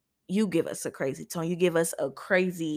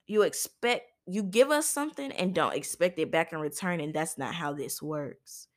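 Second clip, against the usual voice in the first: ordinary talk, nothing marked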